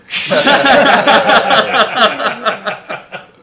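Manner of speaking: high, long cackling laughter; real helpless burst of laughing; long loud laugh. Real, not acted. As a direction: crack up at your own joke. Laughs at own church bit.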